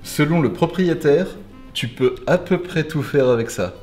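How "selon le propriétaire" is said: usual speaking voice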